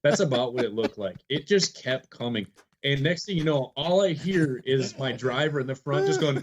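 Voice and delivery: ordinary delivery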